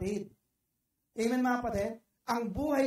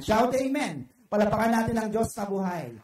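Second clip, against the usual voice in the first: calling out; 7 dB louder than usual. Real, not acted. Preacher calls congregation to respond.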